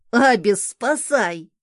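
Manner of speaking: loud mock plea for rescue; playful cry for help. A narrator's reading. Kissed son calling the cat, playful cry. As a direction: call out loudly for help, in play.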